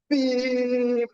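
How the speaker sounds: high sustained beep like a horn; honking beep, pitched high; imitated car horn. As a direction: mimic a long car horn blast. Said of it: "I laid on that horn".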